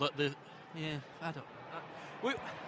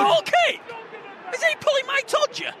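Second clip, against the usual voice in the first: loud, much higher cry of disbelief; disbelieving shout; 7 dB louder than usual. Real, not acted. Fan shocked by a goal-kick decision, loud disbelieving shout. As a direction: shriek in disbelief at the call.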